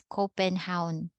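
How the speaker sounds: Copenhagen said as Copen-HOWN; native Danish pronunciation. Shown as a demonstration. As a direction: merge the end of Copenhagen into one syllable, hown. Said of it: "they pronounce this as".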